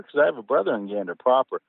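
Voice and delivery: ordinary talk, nothing marked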